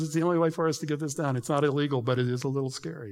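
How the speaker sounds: ordinary talk, nothing marked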